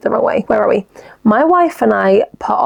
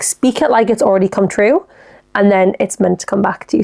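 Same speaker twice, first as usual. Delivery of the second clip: ordinary delivery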